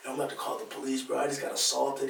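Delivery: low, soft mock-dazed voice; barely recovered victim. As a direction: imitate someone only just able to talk. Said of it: "able to talk again".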